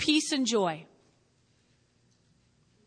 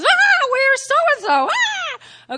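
very high, loud, slow panicked cry; panicked mother; 13 semitones higher, 10 dB louder, much slower than usual. Retold or imitated. Pastor mimics a frantic mother on the phone, shrill panic. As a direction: squeal like a frantic mother hunting her child. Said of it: "she goes".

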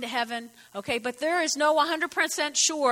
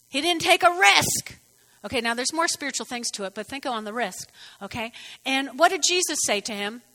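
ordinary delivery

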